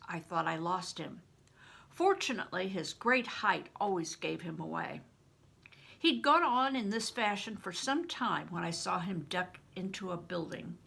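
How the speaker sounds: ordinary delivery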